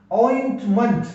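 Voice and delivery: ऑइंटमेंट finishing in a reduced "munt"; weak ending. Shown as a demonstration. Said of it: "last में वीक sound आता है". (ऑइंटमेंट के अंत में हल्का "मंट")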